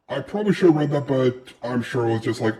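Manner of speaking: ordinary talk, nothing marked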